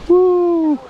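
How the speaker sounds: loud, high joyful whoop. Real, not acted. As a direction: whoop it out with joy. Cheering at the falls.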